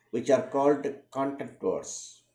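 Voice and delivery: ordinary talk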